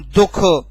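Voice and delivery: दुख with a breathed ha after the kh; visarga weight. Shown as a demonstration. Teacher demonstrating visarga, दुख with breathed ending. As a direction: release दुख into a heavy final ha. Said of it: "आप लोगों के सामने बोलूं".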